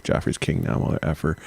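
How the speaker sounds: dropped to a near whisper, mock menace; hushed Cersei quote. Cersei whispering a threat over the torn letter. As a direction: hiss the threat low and soft.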